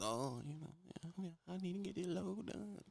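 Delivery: soft, mumbled, half-swallowed words; mumbling young driver. Retold or imitated. Mocking a timid young driver mumbling at the desk. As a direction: mumble it down into your chest. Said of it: "They all get emo".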